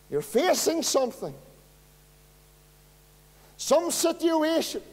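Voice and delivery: high and loud, pressing each line home; preaching at full force. Acted. Preacher calling out the desperate brother and sister, full-force preaching. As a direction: lift the hall, hammer every phrase.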